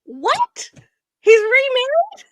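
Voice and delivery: high, loud shocked cry, stretched out; imagine hearing your husband remarried; shocked disbelief. Pretend. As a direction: pretend outburst she held back, voice cracking high. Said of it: "And I'm like / I didn't say that".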